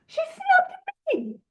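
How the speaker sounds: mock whiny victim. Mocking his own resentment to the group, whiny high voice. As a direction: whine it like a wounded child.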